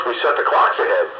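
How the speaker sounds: ordinary talk, nothing marked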